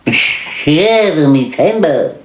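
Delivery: high, slow, swooping cartoon sailor; Popeye. Answering who says that, high exaggerated cartoon sailor voice. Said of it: "Fucking Popeye".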